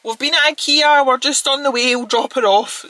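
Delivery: high, loud and bright, calling out; sister's cheery voice. Retold or imitated. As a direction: call out to her sister from the background. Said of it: "her sister being like".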